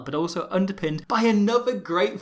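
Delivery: usual speaking voice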